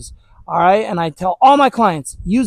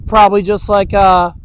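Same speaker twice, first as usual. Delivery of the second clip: ordinary talk, nothing marked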